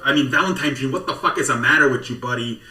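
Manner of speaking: usual speaking voice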